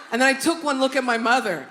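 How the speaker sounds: ordinary talk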